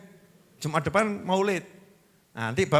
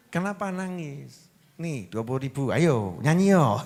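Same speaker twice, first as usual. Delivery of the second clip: comforting parent; 5 semitones lower, 4 dB quieter than usual. Acted. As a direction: gently coax a crying child to cheer up. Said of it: "Kita hibur tuh yang, yang nangis".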